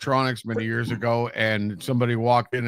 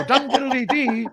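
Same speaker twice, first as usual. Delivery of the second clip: higher, slow singsong "Dum diddly dee"; mock Irish jig; 11 semitones higher, slower than usual. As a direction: lilt it like a stage Irishman. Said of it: "Irish people are the ones who go".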